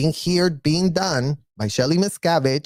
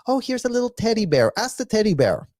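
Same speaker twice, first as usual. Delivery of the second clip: higher, sing-song cutesy voice; mock cutesy; 7 semitones higher than usual. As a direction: coo it like talking to a toddler. Cutesy course room.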